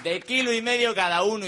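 high, loud mock-defiant repeat; shouted proclamation. Comic insisting on his last wish, shouted declaration. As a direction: hammer it home at high volume.

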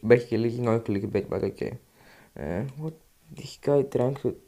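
normal talking voice

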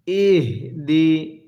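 very slow, measured ইহদি; correct H. Shown as a demonstration. Teacher models the H for learners, slow recited ইহদি. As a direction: sound out ইহদি in two separated syllables, the H breathed out. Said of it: "আমার সাথে আপনি পড়ুন".

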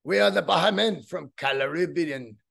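wobbly mock Caribbean accent; bad Jamaican accent. Band refusing the label's song, in a badly faked Caribbean accent. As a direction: do a terrible, hammy Jamaican accent. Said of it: "it's like a bad Jamaican".

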